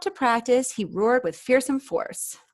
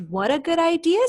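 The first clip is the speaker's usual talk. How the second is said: high, louder happy dragon; cheerful Zog; 8 semitones higher, 5 dB louder than usual. Acted. Zog thanks the girl, a high happy cry. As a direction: chirp it out delighted. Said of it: "said Zog".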